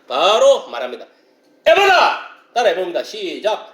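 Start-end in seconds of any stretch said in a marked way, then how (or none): 1.6-2.2 high, loud cry of command; commanding shout. Retold or imitated. Preacher voices the command after prayer, commanding shout. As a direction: call it out high and forcefully. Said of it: "기도하고 나서 뭐라 했냐".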